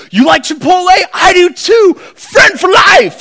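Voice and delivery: gushing, loud, high-pitched new best friend; hammed-up instant-friend enthusiasm; over-excited mock bonding. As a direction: blurt it out high and loud, drawing out the last words. Mocking shallow instant friendship.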